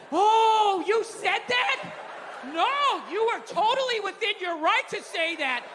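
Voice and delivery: high, slow, gushing over-interested listener; husband faking rapt interest in his wife's gossip; mock attentive husband. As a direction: play the overly supportive husband hanging on every word.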